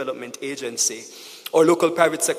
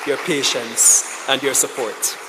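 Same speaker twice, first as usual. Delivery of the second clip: ordinary delivery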